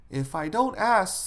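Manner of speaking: high-pitched mother's cue; mother prompting. Acted. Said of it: "said the mother".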